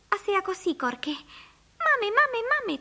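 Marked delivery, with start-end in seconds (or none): 1.1-2.8 put-on very high chirping child voice. A narrator's reading. Tiny bird-boy calling his mother, chirping. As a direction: cheep it like a little bird. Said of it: "cvrlikal Filip".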